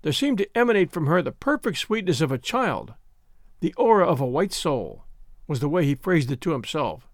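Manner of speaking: usual speaking voice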